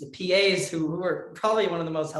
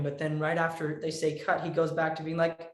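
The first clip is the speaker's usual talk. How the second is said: ordinary talk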